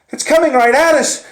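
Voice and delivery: high, loud alarmed shout; panicked yell. Retold or imitated. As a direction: scream the warning in fear. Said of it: "Rene yelled".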